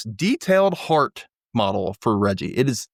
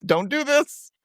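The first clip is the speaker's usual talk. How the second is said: squeaky, much higher put-upon whine; high mock exasperation. Daisy telling the sad string quartet to stop, in a high cartoon whine. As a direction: squeal it like a cartoon diva who is fed up.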